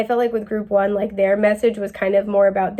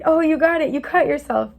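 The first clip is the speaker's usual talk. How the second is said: higher, mock cheering; mock encouragement; 6 semitones higher than usual. Reader cheering a slipping person, playfully higher. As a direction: cheer them on, half joking.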